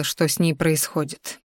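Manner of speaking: ordinary delivery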